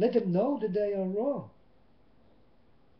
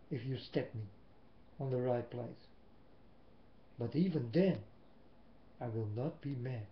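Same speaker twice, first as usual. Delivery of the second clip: hushed; 8 semitones lower, 8 dB quieter than usual. Real, not acted. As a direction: lower and softer, almost under the breath. Quiet vow of non-anger.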